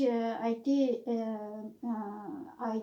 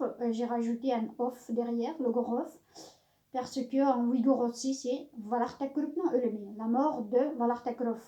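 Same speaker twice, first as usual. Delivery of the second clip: ordinary talk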